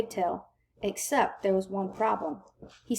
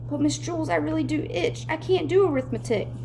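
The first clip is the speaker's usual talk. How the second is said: whining; 7 semitones higher than usual. A narrator's reading. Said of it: "Dana whined".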